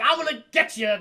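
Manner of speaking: high, loud acted threat; shouted threat. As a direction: shout it like a movie hero attacking. Voicing Borans threatening the creature.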